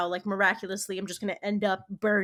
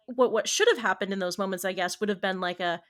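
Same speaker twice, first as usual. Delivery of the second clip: usual speaking voice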